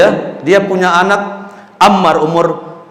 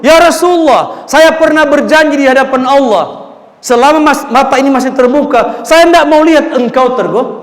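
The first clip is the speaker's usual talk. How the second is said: fervent vow; 7 semitones higher, 3 dB louder than usual. Acted. Nusaibah's vow to the Prophet.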